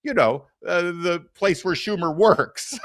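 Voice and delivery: usual speaking voice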